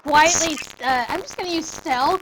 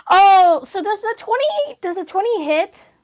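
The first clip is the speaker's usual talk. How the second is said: suddenly higher, bursting in with a loud oh; light up at a lucky roll; real excitement; 5 semitones higher, 6 dB louder than usual. Real, not acted. Player asking if a twenty hits, excited.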